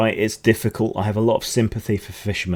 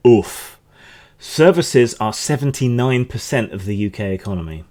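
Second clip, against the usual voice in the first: normal talking voice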